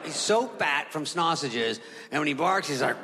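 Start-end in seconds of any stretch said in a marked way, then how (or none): none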